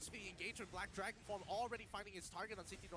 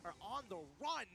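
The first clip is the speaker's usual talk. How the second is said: esports caster; 5 semitones higher, 4 dB louder than usual. Real, not acted. Hyped play-by-play of a fight.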